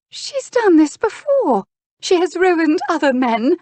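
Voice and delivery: very high, loud, dramatic dismay; high-pitched anguished duchess; horrified accusation. Acted. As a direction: cry out in scandalised horror.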